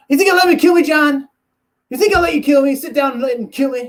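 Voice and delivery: loud, high-pitched indignant outburst; freaking out. Retold or imitated. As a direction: imitate a fighter suddenly exploding at his rival. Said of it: "freaks out out of nowhere".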